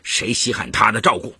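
put-on scornful old husband, high and emphatic; high-pitched, contemptuous grumbling; disdainful. A narrator's reading. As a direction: huff dismissively, building to loud indignation. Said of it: "董传林不屑地说".

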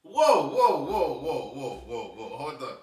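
loud shocked high "whoa"s fading down; startled outcry, calming down. Real, not acted. Shocked by the topic.

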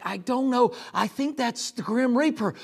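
loud, high, frantic panic; high, shouted fright; panicked disciples. Acted. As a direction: cry out in terror at a ghost. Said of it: "something that's there that's like".

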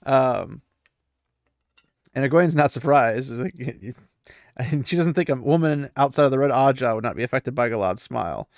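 ordinary talk, nothing marked

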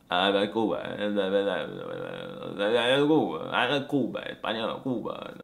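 mumbled Caribbean Spanish, dropped consonants; Cuban accent. Shown as a demonstration. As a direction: swallow the endings like a Cuban. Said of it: "como si fuera de Cuba".

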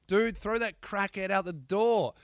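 hoarse, strained Australian voice; pushing a worn-out voice; voice giving out. Real, not acted. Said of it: "losing my voice".

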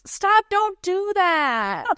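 shocked playful scolding, stretched slow; mock outrage. Real, not acted. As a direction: drag out a scandalized stop. Friend jokes about morbid topic.

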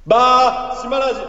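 shouted high title call; loud title call. Acted. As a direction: belt out the corner name up high. Announces the bar corner title. (高く叫ぶタイトルコール)

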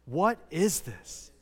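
slow, at a normal volume; leading a group repeat. Shown as a demonstration. Preacher has the congregation say the words with him, slowly. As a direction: spell it out slowly for everyone to join. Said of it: "Say those words with me".

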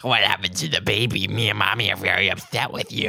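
creepy phone-caller voice; creepy man. Retold or imitated. Creepy man phoning the sorority girl, creepy put-on voice. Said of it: "It's a creepy man calling like this".

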